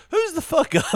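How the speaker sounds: high, laughing mock question. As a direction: squeak it out through laughter. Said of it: "they were like".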